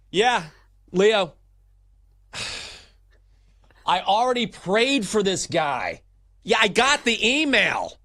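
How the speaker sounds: high, loud busy executive brushing off calls; impatient office boss voice; annoyed boss on the phone. Pretend.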